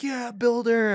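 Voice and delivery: high cheer from the crowd; cheering buddies. Retold or imitated. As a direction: mimic friends hyping him up from the front row. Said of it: "They're like".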